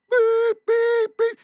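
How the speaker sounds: high, loud electronic beeping; imitated warning beeps. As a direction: beep like a dashboard alarm. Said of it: "it starts beeping".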